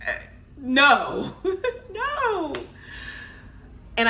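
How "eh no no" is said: high, laughing refusal. Retold or imitated. Recalling her reply to the nurse, high and laughing. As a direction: laugh the no away in disbelief. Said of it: "I was like".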